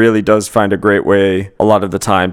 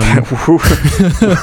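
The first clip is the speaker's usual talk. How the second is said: cartoon laugh; 7 semitones higher, 3 dB louder than usual. Real, not acted. Laughs at silly name.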